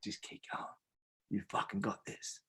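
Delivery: very soft, near-whispered encouragement; quiet private pep talk, barely voiced at first; encouraging inner voice. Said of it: "another part of you that says".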